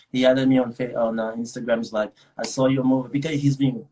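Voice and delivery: ordinary talk, nothing marked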